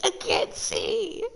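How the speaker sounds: dropped low and slow, croaked through a laugh. Real, not acted. Streamer still laughing, dropped to a low croak. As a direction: groan the words out mid-laugh.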